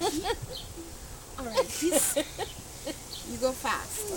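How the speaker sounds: ordinary talk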